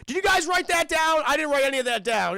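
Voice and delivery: mock panic. Official asking his team after Hans hangs up, mock panicked. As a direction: imitate an official in a loud fluster.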